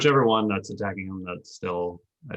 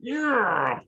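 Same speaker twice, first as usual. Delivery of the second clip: high-pitched drawn-out whoop; excited cheer; 12 semitones higher than usual. Real, not acted. Player celebrating damage dealt to a monster, excited cheer.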